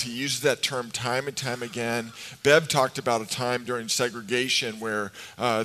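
usual speaking voice

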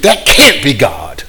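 normal talking voice

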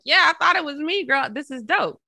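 excited quote. Retold or imitated. Thanking the girl for the poster, thrilled and high. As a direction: gush with delight at the picture. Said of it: "I'm like".

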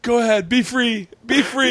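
high, hammed-up send-off; mock dramatic farewell. Mock owner freeing cats in the woods, theatrical farewell. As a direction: release them grandly into the wild.

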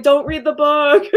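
loud, high playful plea; mock pleading. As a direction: beg her teasingly. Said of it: "You're like".

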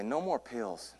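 softly spoken comic aside; quiet aside. Real, not acted. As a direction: drop the volume for a private joke. Joking aside, said then whispered.

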